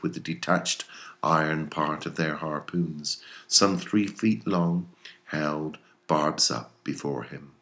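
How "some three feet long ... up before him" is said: ordinary delivery, nothing marked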